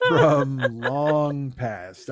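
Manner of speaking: very slow, word by word; writing it down. Real, not acted. Writing down the name of the show, slowly spaced out. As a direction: dictate it to yourself, stretching each word. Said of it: "Let's write it down".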